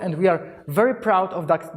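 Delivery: ordinary delivery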